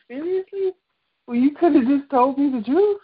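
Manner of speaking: high-pitched retold disbelief; indignant, rising questioning; incredulous. Confronting close ones who betrayed her, incredulous.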